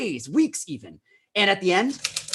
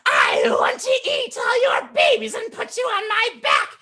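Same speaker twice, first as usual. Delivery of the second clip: very high, loud squeaky villain; squeaky unicorn voice; 5 dB louder than usual. Acted. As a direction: shout it like a squeaky bad unicorn. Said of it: "The unicorn said".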